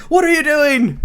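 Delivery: high-pitched alarmed yelp, imitated; hammed-up startled outcry; mock alarm. Retold king panicking as armor is smashed.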